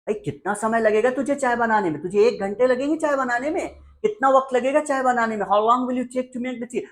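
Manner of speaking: high-pitched, impatient scolding; exasperated mother. Acted. Mother demanding how long tea will take, exasperated. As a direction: push the voice up high like a fed-up mom.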